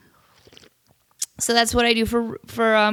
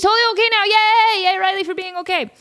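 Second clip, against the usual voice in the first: high, loud happy cheer for the dog; squealing yay for Riley; 10 semitones higher, 3 dB louder than usual. Real, not acted. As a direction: celebrate Riley's recovery.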